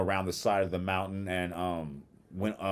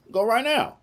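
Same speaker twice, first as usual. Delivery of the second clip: insisting; 8 dB louder than usual. Retold or imitated. The husband insists.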